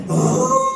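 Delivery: loud, high mock wail; high burning-mouth cry. Acting out panting, burning mouth.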